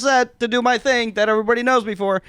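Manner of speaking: mock bored actor. Actor complaining about typecasting, fast mock sarcasm. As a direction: rattle it off like a jaded typecast actor. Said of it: "it's just like".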